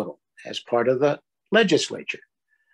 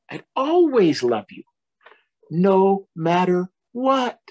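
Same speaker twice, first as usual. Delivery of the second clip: loving reassurance; 6 semitones higher than usual. Acted. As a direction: stretch it out like a cuddle. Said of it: "said Large".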